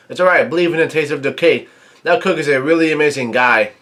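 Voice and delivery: ordinary delivery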